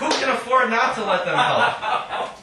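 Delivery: measured, deliberate reading voice; poetry reading. Acted. Poet reading her own poem to an audience, measured reading voice. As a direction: read each line out to the room.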